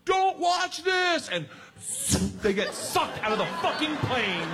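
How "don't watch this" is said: loud, high, stretched-out holler; shouted warning. Retold or imitated. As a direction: imitate a yell from an open plane door. Rachel yells before jumping.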